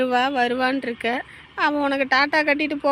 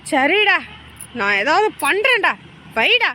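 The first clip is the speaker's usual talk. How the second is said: loud exasperation; 2 semitones higher, 5 dB louder than usual. Acted. Promising the pestering caller to do something, loudly exasperated. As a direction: get the caller off the line forcefully.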